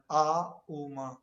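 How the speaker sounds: A and um sounded out slowly as separate syllables; teaching the syllables of Om; sounding out Om. Shown as a demonstration. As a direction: break "A, um" into its two sounds, demo style. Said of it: "these syllables are common".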